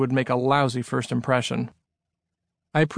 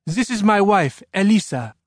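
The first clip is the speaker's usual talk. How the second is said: put-on higher-pitched French-accented man; 6 semitones higher, 3 dB louder than usual. A narrator's reading. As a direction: introduce yourself in a French accent. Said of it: "in a French-sounding accent".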